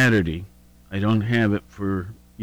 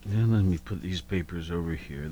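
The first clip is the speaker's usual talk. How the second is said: normal talking voice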